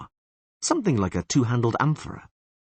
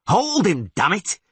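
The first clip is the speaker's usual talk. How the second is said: straining shout; 11 semitones higher, 4 dB louder than usual. Acted. Geralt straining at the line.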